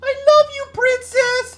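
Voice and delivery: put-on very high, loud child's yell. A narrator's reading. Justin calling to the princess from the mob, yelling shrilly high. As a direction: squeal it out like a lovestruck little boy. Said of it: "yelled Justin".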